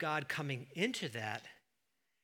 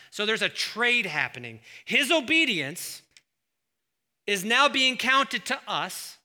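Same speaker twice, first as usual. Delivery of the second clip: full force preaching; 5 semitones higher, 10 dB louder than usual. Acted. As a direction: drive it home to the congregation.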